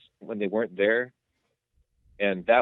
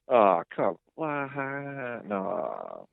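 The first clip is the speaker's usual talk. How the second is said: disappointed groan; 5 dB quieter, much slower than usual. Retold or imitated. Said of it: "and then I was like".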